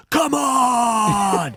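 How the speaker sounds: drawn-out, high battle bellow; hammed-up roaring challenge, pitched high; bellowed shout. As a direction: imitate a defiant bellow, stretching it out. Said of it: "and then he bellows".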